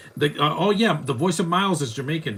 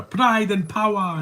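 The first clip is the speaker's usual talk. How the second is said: put-on loud, drawn-out Jamaican accent, pitched up; 9 semitones higher, 6 dB louder, slower than usual. Movie fan imitating the Jamaican accents from Cool Runnings, loud Jamaican accent. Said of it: "They sound way deeper".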